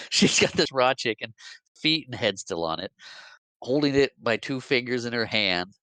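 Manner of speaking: ordinary delivery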